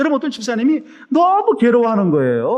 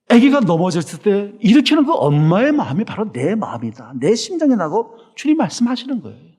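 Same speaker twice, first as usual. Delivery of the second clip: ordinary talk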